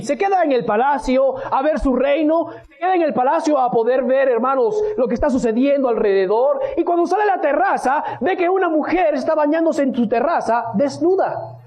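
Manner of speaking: usual speaking voice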